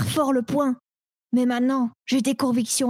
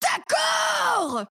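excited shout; 6 semitones higher, slower than usual. Acted. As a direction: blurt the choice out. Excited choice.

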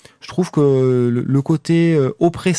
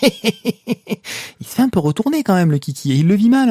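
laughing; 8 semitones higher than usual. Real, not acted. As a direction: laugh through the words. Laughing at the berserker.

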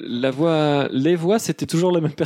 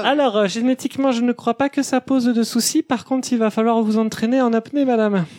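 operator voice; 8 semitones higher than usual. Acted. Operator answering caller.

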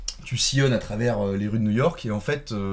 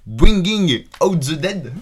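slow, louder, hammed-up English accent, higher pitch; 7 semitones higher, 10 dB louder, much slower than usual. Shown as a demonstration. [name] gives the English title in a hammed-up English accent. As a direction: show off a mock-proud English accent, laughing. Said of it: "alors mon super accent anglais".

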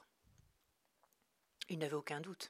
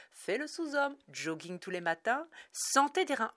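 high, loud mock health slogan; 7 semitones higher, 8 dB louder than usual. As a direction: parody a propaganda jingle.

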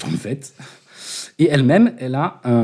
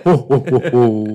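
a touch of jolly ho-ho-ho; lightly voiced Santa laugh; Père Noël; 5 dB louder, slower than usual. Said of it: "pour moi, c'est le Père Noël".

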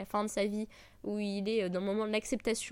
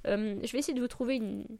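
ordinary delivery

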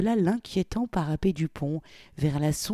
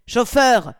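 loud, higher call to the driver; commanding call; 8 semitones higher, 10 dB louder than usual. A narrator's reading. Polly loudly calling the chauffeur through the speaking tube. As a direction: order the chauffeur sharply. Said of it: "ordonna-t-il".

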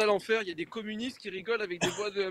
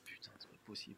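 exasperated whisper; whispered swear; 11 semitones lower, 24 dB quieter, faster than usual. Real, not acted. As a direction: breathe it out in disbelief. Exasperated under his breath. (chuchoté, exaspéré)

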